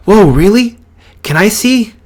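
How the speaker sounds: put-on high, eager kid voice; excited. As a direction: gasp it out, then ask eagerly. Friend reacts to quirk news.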